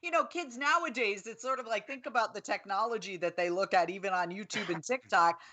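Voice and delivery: ordinary delivery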